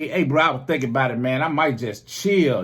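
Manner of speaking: lounge through it like you own the couch; mock slacker voice of the house guest; lazy friend.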